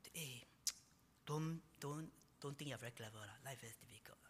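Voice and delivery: hushed, fading to a whisper; quiet warning. Acted. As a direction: murmur it like life humbling you. Voicing life's quiet warning.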